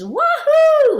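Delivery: a huge high, loud whoop of glee; joyful whoop. Retold or imitated. The elderly wife leaping off the platform, whooping with glee. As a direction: let it rip like jumping off a cliff.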